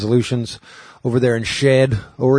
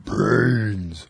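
zombie voice. Retold or imitated. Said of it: "he sounded like a zombie".

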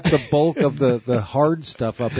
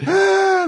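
high bawling cry; mock wail; 4 dB louder than usual. Kid imitated bawling after the prank, high wail. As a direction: howl like a crying child. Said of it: "He's just bawling".

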